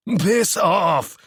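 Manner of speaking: high, angry cry; snarled. Acted. Ewan kicking Seb away, angry cry. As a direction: fight him off with the words.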